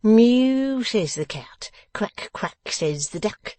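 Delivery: jeering animal noises, high loud mew; mock him with nursery animal cries; contemptuous mockery. A narrator's reading. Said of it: "retorted Mister Boffin with ineffable contempt".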